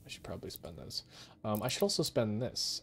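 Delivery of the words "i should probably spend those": soft unvoiced whisper. Real, not acted. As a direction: breathe it out in a whisper. Repeats line, second time whispered.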